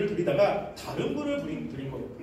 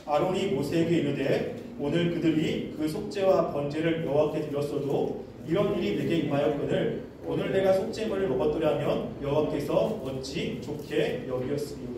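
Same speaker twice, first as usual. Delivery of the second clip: low, even Bible-reading voice; scripture reading; 6 semitones lower than usual. Real, not acted. Preacher leading a reading of Leviticus, low recitation. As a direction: read the verses out in a lower, measured monotone. Reads the scripture passage aloud.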